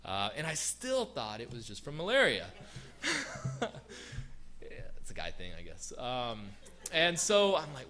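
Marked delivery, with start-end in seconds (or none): 2.5-4.6 breathy real laugh. Real, not acted. Laughing at himself for blaming malaria.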